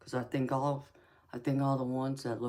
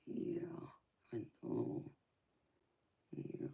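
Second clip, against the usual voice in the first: whispering; 11 semitones lower, 8 dB quieter than usual. Real, not acted. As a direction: whisper it tenderly. Whispering to baby.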